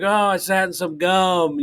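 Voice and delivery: high, slow mock-innocent voice; Sully's innocent excuse. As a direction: play dumb, drawn out and high.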